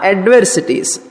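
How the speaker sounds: normal talking voice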